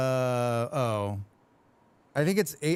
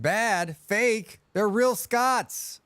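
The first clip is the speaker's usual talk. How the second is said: higher, slow, hammed-up outrage; mock indignation; 8 semitones higher, much slower than usual. Defending the game's dwarves to chat in mock outrage. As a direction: pretend to be offended for the dwarves.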